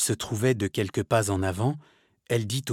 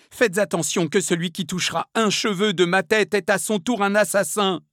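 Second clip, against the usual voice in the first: high-pitched angry warning; acted desperate menace; defiant threat; 9 semitones higher, 4 dB louder than usual. A narrator's reading. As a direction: warn them off in a raised, high voice. Milady threatening her executioners.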